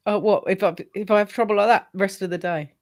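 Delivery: ordinary talk, nothing marked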